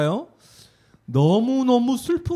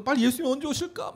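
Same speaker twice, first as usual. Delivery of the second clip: anxious waiting; 7 semitones higher, 4 dB quieter, faster than usual. Retold or imitated.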